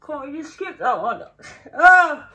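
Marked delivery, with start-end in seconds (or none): 1.8-2.3 pained shriek. Real, not acted. Tasting sour candy.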